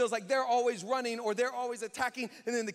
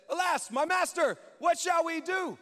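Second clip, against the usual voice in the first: high, loud alarmed cry to his master; panicked cry; 8 semitones higher, 7 dB louder than usual. Acted. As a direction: shout it out like a terrified servant. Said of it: "Exclamation mark".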